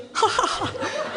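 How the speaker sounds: high, forced ha-ha-ha; fake kidding laugh. She pretends to the boat she was kidding, forced laugh. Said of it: "tried to play like I was kidding".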